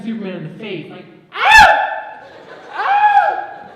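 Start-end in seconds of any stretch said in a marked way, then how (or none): 1.3-3.7 piercing high, loud yelps; mock fight screeches. Imitates face-scratching fight.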